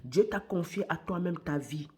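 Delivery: ordinary talk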